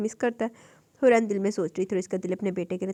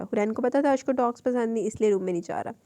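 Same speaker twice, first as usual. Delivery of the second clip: ordinary delivery, nothing marked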